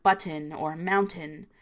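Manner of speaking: BUTTON and MOUNTAIN with the middle T fully sounded; different accent. Shown as a demonstration. Showing another accent by contrast, middle T pronounced. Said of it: "it would be a different accent".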